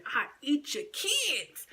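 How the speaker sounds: slow, high growled boast; Tyson impression. Fighter voice boasting to a bully. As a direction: channel Mike Tyson's threat. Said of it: "felt like Tyson at this moment".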